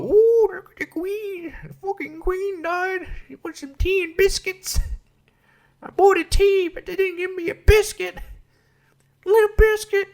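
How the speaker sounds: very high, silly British falsetto; hammed-up squeaky British caricature; mock British people. Imitating British people fussing over tea and biscuits, silly falsetto. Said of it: "have British people go".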